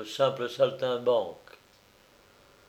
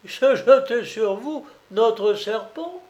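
much higher, louder doubting question; incredulous question; 9 semitones higher, 4 dB louder than usual. A narrator's reading. Colombine disbelieving his story.

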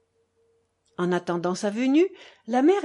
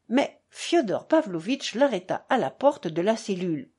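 ordinary delivery

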